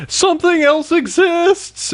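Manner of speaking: high, slow mock amazement. Fans mocking the show's new places, in slow high amazement. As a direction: gasp it out like a wide-eyed fan.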